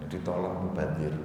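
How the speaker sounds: ordinary talk, nothing marked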